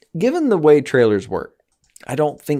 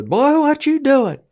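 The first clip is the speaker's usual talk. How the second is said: high, slow mock Southern drawl; imitating a gruff Southern man; 4 dB louder, slower than usual. Mock-scolding a boy in a Southern drawl. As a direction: drag it out like a scolding local. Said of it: "I could definitely hear".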